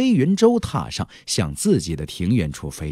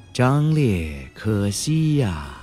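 very slow, drawn-out lament; deep regret; much slower than usual. A narrator's reading. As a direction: let each word trail out heavy with regret. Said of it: "却浓浓的惋惜之意毫无遮掩地流露出来". (缓慢拖长，满是惋惜)

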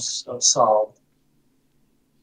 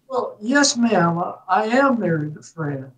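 put-on Southern accent, starting high and settling down; reply with mock Southern courtesy; playfully imitated Southern politeness; best Southern accent; 5 semitones higher than usual. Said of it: "in my best Southern accent".

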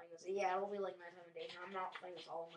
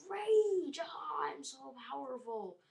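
very high, loud burst that settles slightly; hammed-up boasting battle cry; mock power cry; 8 semitones higher, 8 dB louder, much slower than usual. Acting Pokemon boasting of power.

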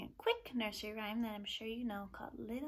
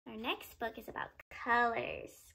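normal talking voice

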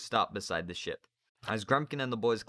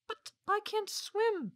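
put-on frightened high boy; slower than usual. Narrator voices James.

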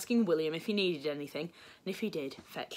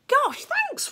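high, loud burst of gratitude; thrilled little boy's thanks; delighted thanks; 6 dB louder than usual. A narrator's reading. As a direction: gush with high-pitched delight. Charlie delighted by chocolate offer.

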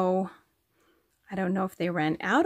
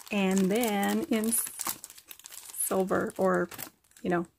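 ordinary delivery

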